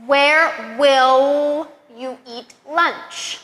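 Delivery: slow, clear sentences with the L on "will" held; slow model sentences. Shown as a demonstration. Teacher models practice sentences for learners, slowly. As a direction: say each sentence slowly for repetition. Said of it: "practice several sentences with that".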